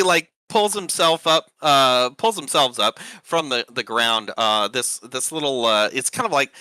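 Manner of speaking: normal talking voice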